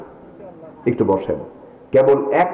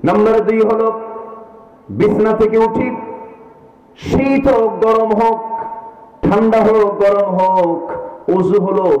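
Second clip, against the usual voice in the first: fiery preaching; 8 semitones higher, slower than usual. Real, not acted. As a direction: preach it out to the crowd. Preaching at full force.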